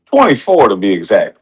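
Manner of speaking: usual speaking voice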